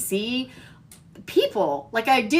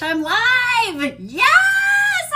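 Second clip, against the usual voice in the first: real squeal of joy, high and loud; it's live, yelling with joy; excited; 12 semitones higher, 6 dB louder, much slower than usual. Real, not acted. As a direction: burst out with real delight. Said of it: "I'm so excited".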